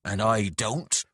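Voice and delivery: slow, loud growl. A narrator's reading. Beatrax rejecting the lad's apology with a growl. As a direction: growl it out heavily. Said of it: "growled Beatrax".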